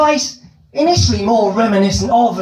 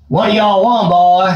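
southern drawl; 4 dB louder than usual. Retold or imitated. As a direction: lean into a hammy Southern states drawl. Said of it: "stick with the Southern States drawl".

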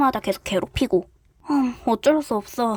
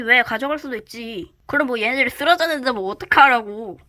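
normal talking voice

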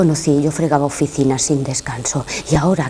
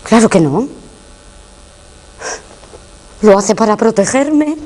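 loud, high-pitched protest; urgent raised voice defending your child; alarmed denial; 6 semitones higher, 5 dB louder than usual. Acted. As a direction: deny it forcefully, pitch climbing at the end. Denies accusation against her son.

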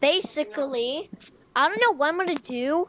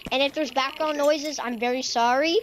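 ordinary delivery

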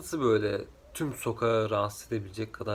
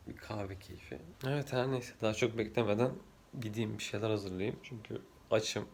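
ordinary delivery

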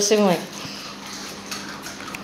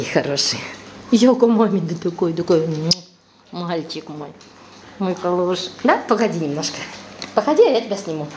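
ordinary delivery

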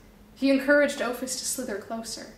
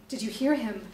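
ordinary delivery, nothing marked

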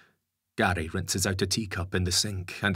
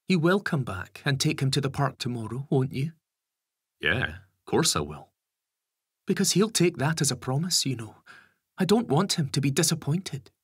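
higher, anxious mother; worried mum voice; 8 semitones higher, 4 dB louder than usual. A narrator's reading. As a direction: press him for a promise. Lisa presses Gary.

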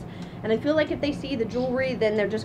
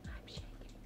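nervous whisper; hushed confession, barely audible; whispered aside; 22 dB quieter than usual. Real, not acted. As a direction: whisper it under your breath. Aside while approaching the birds.

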